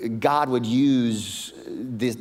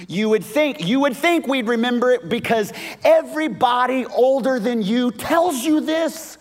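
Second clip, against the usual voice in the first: higher, louder, full-force preaching; fiery preaching; 12 semitones higher, 6 dB louder than usual. Acted. Preacher builds to a passionate climax about God's emotion. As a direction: preach it with everything.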